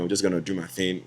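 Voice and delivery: ordinary talk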